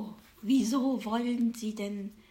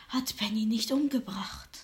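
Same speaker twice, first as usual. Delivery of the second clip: fortune teller. Acted. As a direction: whisper it like a secret. Fortune teller reads cards.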